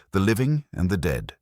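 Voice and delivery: ordinary delivery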